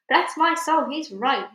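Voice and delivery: loud high exclamation; exclaiming. A narrator's reading. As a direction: burst out with alarm. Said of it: "the old green grasshopper exclaimed".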